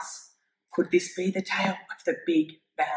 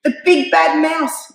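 slow, loud, higher frightened yell; 8 semitones higher, 7 dB louder than usual. Acted. The Gruffalo's child yells in fright at the shadow, loud and slow. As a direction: cry it out in alarm. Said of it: "yelled the Gruffalo's child".

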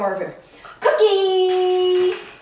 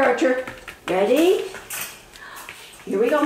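usual speaking voice

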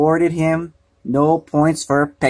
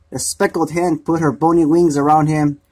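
ordinary delivery

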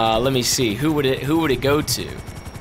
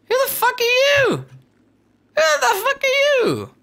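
much higher, shocked yell; baffled outrage. Real, not acted. Confronting a stranger in his game, high and baffled. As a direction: demand it in disbelief.

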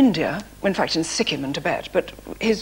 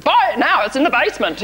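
higher, louder crisp sales pitch; bright snappy advert read; 6 semitones higher, 5 dB louder than usual. Shown as a demonstration. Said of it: "they want sort of crisp".

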